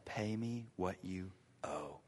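hushed demand. Acted. God watching you say.

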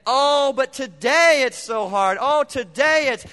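whiny complaint. Retold or imitated. Congregation complaining about today, hammed-up whine. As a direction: whine it like it's all too hard. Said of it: "tendency to say".

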